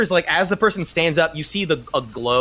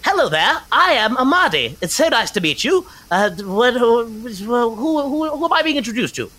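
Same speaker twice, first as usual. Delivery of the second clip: high, bright-eyed tinkerer greeting strangers; eager inventor voice; 9 semitones higher than usual. Acted. Game master voicing the inventor Amadi meeting the party, high and eager. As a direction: lift it way up and gush at the newcomers.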